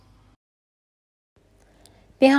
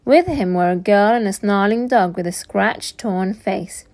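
usual speaking voice

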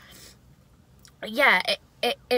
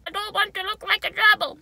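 much higher, louder squeaky whine; squeaky silly voice; 12 semitones higher, 9 dB louder than usual. Real, not acted. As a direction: squeal it like a cartoon rodent. Silly reaction to gerbil face.

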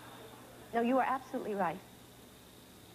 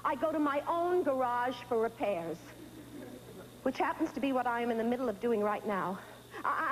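normal talking voice

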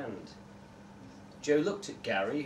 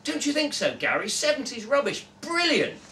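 much higher, excited and gushing; delighted; 8 semitones higher, 4 dB louder than usual. Acted. Joe marvels at seventies litter to Gary, high and excited. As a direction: gush over the old litter.

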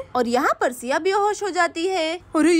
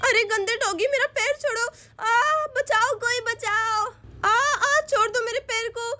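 crying in pain; 3 semitones higher than usual. Acted. Siya begging the dog to let go, crying in pain. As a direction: cry out as the dog bites.

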